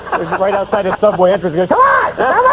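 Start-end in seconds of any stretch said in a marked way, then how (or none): 1.7-2.5 loud, high-pitched calling out; beckoning shout. Acted. Mock killers waiting outside the subway entrance, eager beckoning shout. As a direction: yell it to people coming out of the subway.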